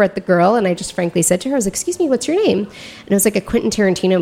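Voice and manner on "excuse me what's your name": sharp high-pitched challenge; pointed high question to a stranger; retold confronting the stranger, pitched high.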